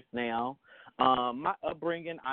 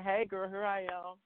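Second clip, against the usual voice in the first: sassy voice; 7 semitones higher, 3 dB quieter than usual. Retold or imitated. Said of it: "decided to say".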